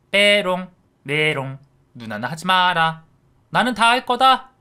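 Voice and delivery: loud, high singsong raspberry; taunting kid brother. A narrator's reading. Brother taunting his sister, loud high singsong. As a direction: stick your tongue out with it.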